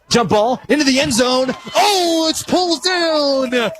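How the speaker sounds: real thrill of the big catch, rising to a peak on oh; excited touchdown call. Real, not acted. Announcer to radio listeners, calling a leaping touchdown catch, thrilled and high. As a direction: voice leaps up high and stretches as the ball is pulled down.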